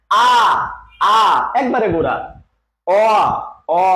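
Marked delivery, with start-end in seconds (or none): 0.1-1.2 teacher modelling the open আ; vowel demo. Shown as a demonstration. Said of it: "দেখেন". 2.9-3.8 rounded vowel অ said clearly; teacher contrasting অ with আ; vowel demo. Shown as a demonstration. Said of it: "অটা দেখেন".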